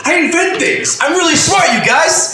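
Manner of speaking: high shouted mock Wahlberg; shouting inventor. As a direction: yell it high like a dumb boast. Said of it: "Him saying over and over again".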